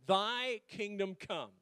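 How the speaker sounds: slow, drawn-out scripture reading; solemn scripture reading. Shown as a demonstration. Pastor reading Matthew six to the church, slow and solemn. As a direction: let each phrase of the verse hang. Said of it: "here's my scripture".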